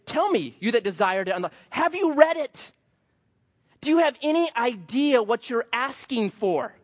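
high, incredulous exasperation; perplexed Paul. Retold or imitated. Paul baffled at the legalists, high and exasperated. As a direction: throw the verse back at them in disbelief. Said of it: "Paul's perplexed".

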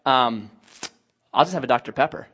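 low, soft, halting reply; hesitant order. Retold or imitated. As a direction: order reluctantly, with an awkward pause after the um. Buddy hesitantly orders in a bar.

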